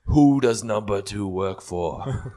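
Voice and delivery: loud, very drawn-out demand; hammed-up, slow, loud interrogation; mock interrogator. Father interrogating the baby, slow loud mock demand.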